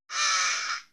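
loud, breathy mock shriek of a mother; mother's mock scream. Mother seeing a spider on her baby, mock shriek.

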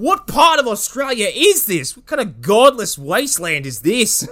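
high, outraged Australian impression; mock Australian horror. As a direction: protest in a hammed-up Australian accent. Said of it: "screaming in horror this entire film".

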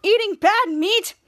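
put-on exasperated scolding; acted indignation. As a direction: stretch out the final accusation. Last item of the scolding list.

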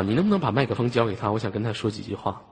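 ordinary talk

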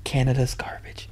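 teasing whisper. Real, not acted. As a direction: hiss it under the breath. Teasing whisper about Canada.

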